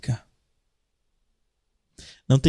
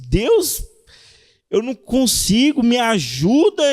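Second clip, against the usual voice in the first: pleading prayer; 6 semitones higher than usual. Retold or imitated. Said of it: "pessoa que tá lutando contra um vício".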